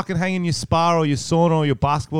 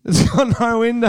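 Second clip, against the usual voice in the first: laughing echo; 9 semitones higher, 5 dB louder than usual. Real, not acted.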